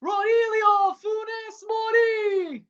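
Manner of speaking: drawn out high name call; scorer's name called. Real, not acted. Commentator crowning the scorer, slow and high. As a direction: stretch the scorer's name like a chant. Naming the scorer after goal.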